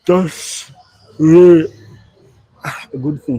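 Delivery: words stretched through a real yawn. Real, not acted. Yawning mid-sentence while chatting to viewers on the live. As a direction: talk while a yawn takes over. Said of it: "I don't know why I'm yawning".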